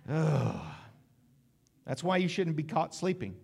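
ordinary delivery

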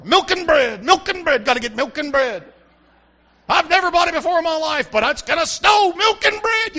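mock panic at the store; hammed-up frantic shopper; panicky shopper. Shoppers panicking before snow.

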